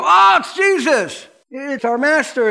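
high, loud cry of relief; excited cry. Retold or imitated. Disciples in the boat spotting Jesus, excited shout. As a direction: call out to him, overjoyed. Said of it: "and they go".

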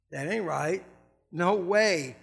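ordinary delivery

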